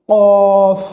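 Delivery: কফ as a long, loud "qoof"; কফ heavy, with a sustained rounded vowel; tongue-root articulation. Shown as a demonstration. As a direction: press কফ out from the back of the tongue. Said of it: "জিহ্বাটা লাগিয়ে উচ্চারণ হবে".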